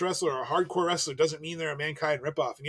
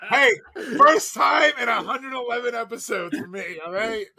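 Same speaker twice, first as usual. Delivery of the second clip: laughing shout; 6 semitones higher, 6 dB louder than usual. Real, not acted. Defending being on mute.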